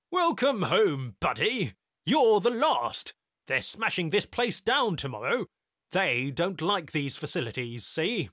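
loud, high brash greeting; brash technician. A narrator's reading. Technician welcoming Tyler home, brash and loud. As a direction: swagger into it.

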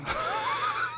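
high shouted laugh, soft at the end. Real, not acted. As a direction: crack up at the punchline. Laughs at own story.

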